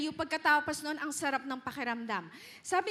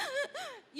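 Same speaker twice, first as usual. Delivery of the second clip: high, hiccuping gasp of a cry; sobbing gasp; 10 semitones higher, 5 dB quieter than usual. Shown as a demonstration. Preacher imitates crying until no tears are left, gasping. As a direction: gasp like you've run out of tears. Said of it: "umiiyak kayo na".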